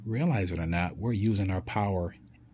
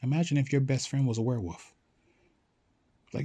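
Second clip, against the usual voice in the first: ordinary delivery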